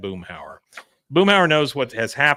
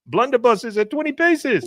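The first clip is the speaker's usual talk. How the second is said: much higher put-on Khan voice; Khan impression; 11 semitones higher than usual. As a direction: deliver it proudly as Khan. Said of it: "it was Khan".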